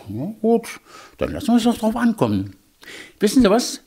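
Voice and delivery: high eager hedgehog. Acted. Hedgehog proposes the race.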